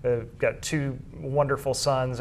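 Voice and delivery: ordinary delivery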